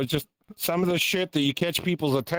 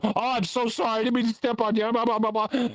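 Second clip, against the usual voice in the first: high-pitched imitation of a gushing apology; hammed-up sorry, running into high babble; flustered mock apology; 8 semitones higher, faster than usual. Mimics flustered apologizers a second time.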